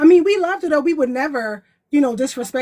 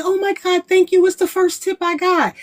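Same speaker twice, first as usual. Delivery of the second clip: high, gushing thank-you voice; grateful valet woman; 7 semitones higher than usual. Retold or imitated. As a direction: squeal with delight at a first tip. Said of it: "She was like".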